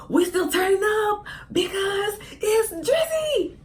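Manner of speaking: high, drawn-out party cheer; hyped up. Acted. Shrugging off a bad score at [name]'s concert, hyped cheering. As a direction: stretch each phrase into a high, excited cry.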